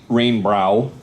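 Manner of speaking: normal talking voice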